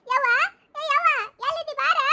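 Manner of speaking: high, loud plaintive call. Acted. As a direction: cry out for her to come. Calling mother-in-law, upset. (ಎತ್ತರದ ಧ್ವನಿಯಲ್ಲಿ ಜೋರಾಗಿ ಕೂಗು)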